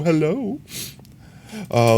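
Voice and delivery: usual speaking voice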